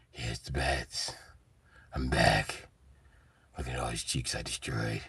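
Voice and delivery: low, soft, slow hushed Batman voice; whispery Batman. Retold or imitated. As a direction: gloat quietly like a creepy hero. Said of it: "he gonna come in and check on y'all".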